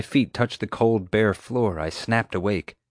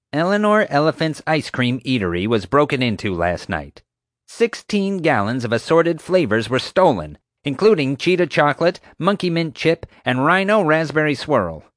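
a higher, louder bossy guard listing the stolen flavors; accusing Marge; 8 semitones higher, 4 dB louder than usual. Acted. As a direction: read the charges like an outraged officer.

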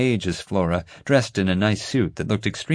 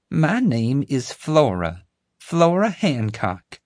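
slow, higher Southern drawl; southern lady; 7 semitones higher, slower than usual. A narrator's reading. Flora introducing herself to the kids, slow Southern drawl.

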